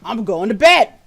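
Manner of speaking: loud sudden announcement; just drop it and leave; abrupt exit. Acted. Game master voices Holly quitting for the night, loud. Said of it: "he's like".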